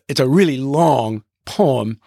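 ordinary delivery

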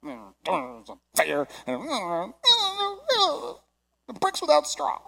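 mock whiny grumble; grumbling. Imitating discouraged Israelites missing the promise, whiny grumbling. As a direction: whine and moan through the complaint. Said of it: "I come from a long line of grumblers".

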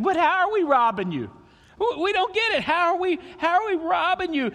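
high, protesting mock outrage; indignant people. People's indignant reply voiced.

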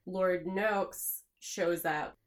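ordinary delivery

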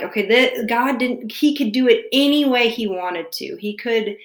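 ordinary delivery

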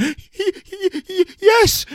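high, loud frightened stutter; terrified stammer. Acted. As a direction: squeak it out in terror. Boy stammering in terror.

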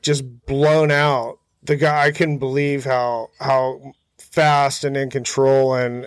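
ordinary talk, nothing marked

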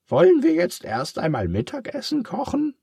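very high, loud pig voice, reassuring; comforting pig. Acted. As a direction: cheer up your friend in a high pig voice. Charles suggests lunch.

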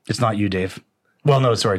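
ordinary talk, nothing marked

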